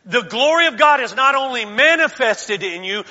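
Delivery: ordinary delivery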